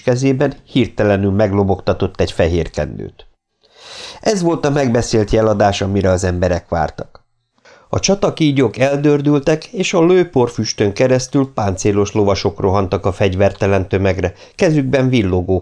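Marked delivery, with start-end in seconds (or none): none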